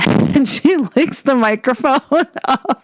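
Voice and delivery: telling it while cracking up; giggling as the punchline lands; laughing through it. Real, not acted.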